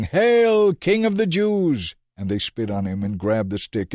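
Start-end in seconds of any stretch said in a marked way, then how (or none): 0.0-1.9 high, loud jeering shout; mock him as a king; mocking yell. A narrator's reading. Soldiers kneel and jeer at Jesus, loud.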